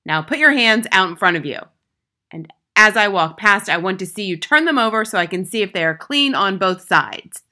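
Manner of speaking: loud inspection orders; stern, booming schoolmistress; tyrannical headmistress, commanding. A narrator's reading. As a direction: order the class about forcefully.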